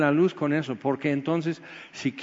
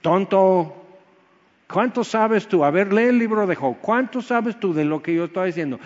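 louder and higher, a stern rebuke; hammed-up stern God; mock scolding; 4 semitones higher, 3 dB louder than usual. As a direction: scold the fool who thinks he knows. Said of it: "más merecido decírmelo así".

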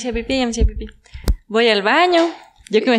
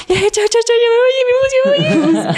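frantic escaping goodbye. Retold or imitated. Escaping a too-close hug, goodbye climbing into a squeak. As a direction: voice climbing ever higher. Fleeing hug with torn pants.

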